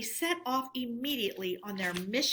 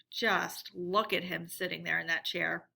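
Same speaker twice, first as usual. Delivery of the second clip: ordinary delivery, nothing marked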